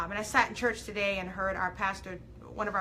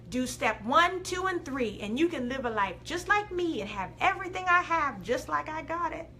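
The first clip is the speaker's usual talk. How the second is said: put-on Southern accent; 5 semitones higher than usual. Jade mimicking herself prescribing steps to followers, Southern accent. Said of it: "I did a Southern accent for that".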